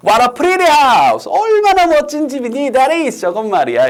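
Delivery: high, hammed-up gushing; exclaiming in admiration. Teacher acts grown-ups admiring a house, exclaiming. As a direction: stretch it out in amazed delight, loud at first. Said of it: "이렇게 감탄한다는".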